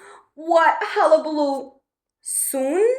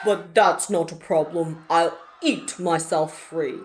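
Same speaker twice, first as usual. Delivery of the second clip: lower, sly dragon voice; smug dragon; 8 semitones lower than usual. Acted. Dragon deciding to eat itself free, lower and smug. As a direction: brush it off slyly.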